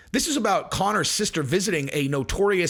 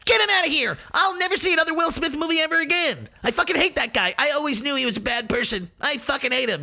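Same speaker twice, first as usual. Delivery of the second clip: mocking fickle fans; 9 semitones higher, faster than usual. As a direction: overblown fake outrage. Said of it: "And they're all".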